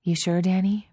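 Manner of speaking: plain reading voice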